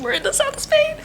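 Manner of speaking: very high, overjoyed squeal; excited squeal. Real, not acted. Traveler repeating to viewers where they are, squealing with excitement. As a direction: squeal the news in sheer delight.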